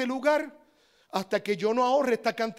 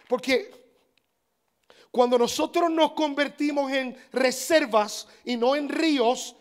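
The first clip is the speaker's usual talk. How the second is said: usual speaking voice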